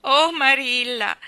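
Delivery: moaning wail. A narrator's reading. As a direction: draw it out in acted despair. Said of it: "gemette la bambina".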